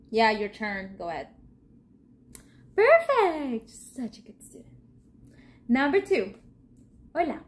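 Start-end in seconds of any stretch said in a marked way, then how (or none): 2.8-3.7 loud, high Perfect for the class; excited praise. Real, not acted. Teacher cheering her students' answer, loud and high. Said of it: "praising the pupils". 3.7-4.7 breathy whisper of fond praise. Real, not acted. Teacher whispering praise to a pupil. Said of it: "aside praise".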